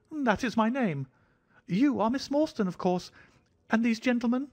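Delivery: fussy, jittery host bowing and scraping; thin high voice. A narrator's reading. Little man greeting Miss Morstan in a thin high voice. Sholto's voice held.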